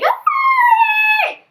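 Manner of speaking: high, drawn-out far-carrying call; dad calling out. Retold or imitated. Dad calling to his lost daughter, long high holler. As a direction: imitate a parent hollering for a lost child. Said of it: "then I heard my dad".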